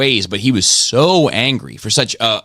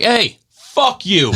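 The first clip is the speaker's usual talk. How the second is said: high, drawn-out mock holler; re-enacted angry callout; retold road-rage yell; 11 semitones higher, much slower than usual. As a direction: call it out across traffic, stretched and high. Retold road-rage shouting match.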